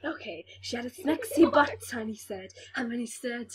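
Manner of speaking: ordinary talk, nothing marked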